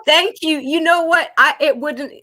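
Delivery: bright, delighted thanks; delighted and excited. Real, not acted. Delighted reply to the advice.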